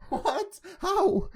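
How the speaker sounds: shocked disbelief. Real, not acted. Co-host hearing the kangaroo story, shocked disbelief. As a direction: react as if you can't believe your ears.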